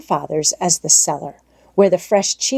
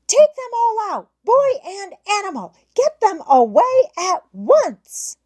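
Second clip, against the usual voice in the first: shrieking. A narrator's reading. Governess shrieking for the butler to remove them. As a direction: shriek for the servant in terror. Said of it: "shrieking with all her might".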